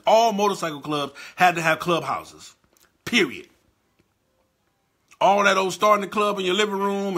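worked-up rant. Real, not acted. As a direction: build the rant up to full volume.

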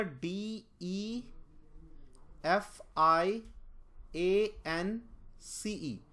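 very slow spelling of each letter; spelled out letter by letter. Shown as a demonstration. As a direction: dictate the letters slowly to the class. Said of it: "तो defiance क्या होगा". (अक्षर-अक्षर, बहुत धीरे spelling)

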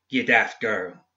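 ordinary delivery

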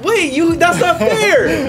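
high-pitched, drawn-out complaint; indignant protest. Real, not acted. Player to rival over an unfair move, indignant. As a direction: protest it high, stretching the words.

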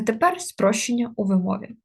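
ordinary talk, nothing marked

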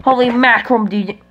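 usual speaking voice

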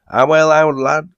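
high, loud obliging call; hearty reply. A narrator's reading. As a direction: shout back willingly to a mate. Enoch calls back obligingly.

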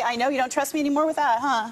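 usual speaking voice

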